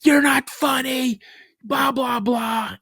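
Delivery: high, drawn-out mock yell; loud, high-pitched freak-out, retold; retold scream. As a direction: imitate a kid yelling at dad. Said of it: "I'm screaming at my dad".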